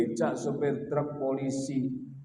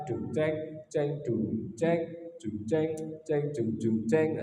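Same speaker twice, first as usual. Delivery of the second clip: soft slow mouth drumming; drum sounds; 5 dB quieter, slower than usual. Shown as a demonstration. Storyteller mimics drum beats for the kids, soft and slow. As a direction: beat out dung ceng like a little drum. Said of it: "Nah, harus gitu".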